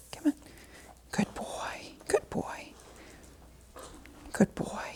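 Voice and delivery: whispered soft praise. Real, not acted. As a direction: breathe the praise gently. Praising dog softly.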